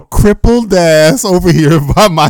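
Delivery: mãe gritando. Retold or imitated. Mother yelling on phone.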